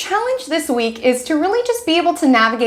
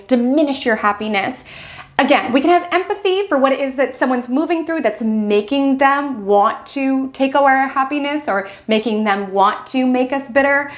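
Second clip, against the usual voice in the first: ordinary delivery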